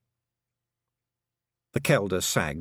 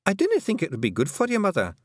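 quick, higher Scots daughter, worried; Fionn worried; 12 semitones higher, faster than usual. A narrator's reading. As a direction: hurry to stop her mother. Fionn cautions mother.

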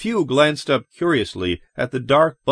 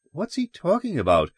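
high, uneasy asking; mildly anxious, raised pitch; nervous question; 7 semitones higher than usual. A narrator's reading. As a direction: ask with a touch of worry. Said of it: "Margaret asked nervously".

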